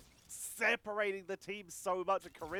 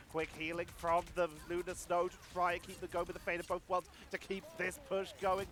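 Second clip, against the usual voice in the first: ordinary delivery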